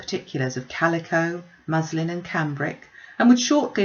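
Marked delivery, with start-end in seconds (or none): none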